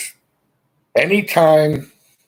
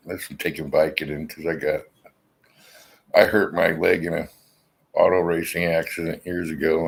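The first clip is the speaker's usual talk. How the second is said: ordinary delivery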